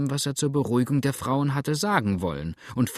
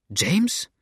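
alarmed cry; 7 semitones higher, 3 dB louder than usual. A narrator's reading. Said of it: "rief die alte Dame".

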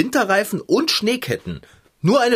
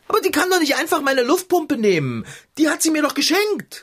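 much higher, indignant protest; 10 semitones higher than usual. Acted. Fahrradschrauber protesting to the cat, outraged.

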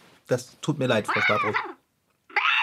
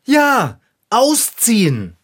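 loud, slow ausziehen, hammered home; emphatic warning; 5 semitones higher, 5 dB louder, much slower than usual. Acted. Warning the cat it must move out, emphatic. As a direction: stress ausziehen slowly and firmly.

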